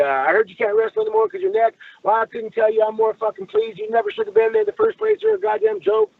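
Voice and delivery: high-pitched sneering tirade; mock him as a loudmouth showing off; imitating a ranting bully, high and mean; mocking Cornette rant. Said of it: "he's putting on a show for the marks".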